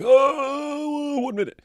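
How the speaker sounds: high, drawn-out mock "oh"; mock a big reaction in a high voice; joking. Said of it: "I was joking when I was like".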